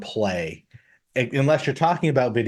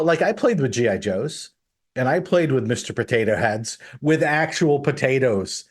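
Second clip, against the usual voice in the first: usual speaking voice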